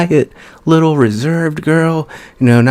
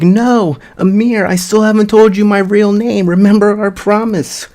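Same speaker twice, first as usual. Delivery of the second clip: desperate plea; 9 semitones higher than usual. Retold or imitated.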